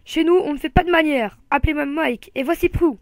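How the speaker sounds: hearty host roaring his welcome. A narrator's reading. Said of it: "avant de rugir".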